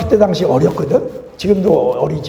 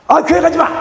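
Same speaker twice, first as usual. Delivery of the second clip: grumpy husband; 11 semitones higher, 5 dB louder than usual. Retold or imitated. Husband snapping at wife.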